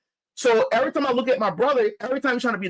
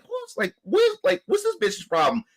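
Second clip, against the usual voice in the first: incredulous outrage; 10 semitones higher than usual. Retold or imitated. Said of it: "I'm like".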